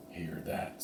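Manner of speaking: breathy whisper to his partner. Acted. Slim warns Jack of the mare, whispering. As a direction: hush it so no one hears. Sneaking up on rustlers' camp.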